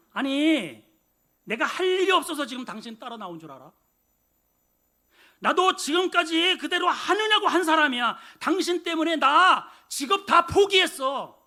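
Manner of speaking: indignant retort. Retold or imitated. Peter protesting to Jesus, loud and indignant. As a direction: fire back, hurt and outraged. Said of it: "이렇게 반응을 해야 옳아요".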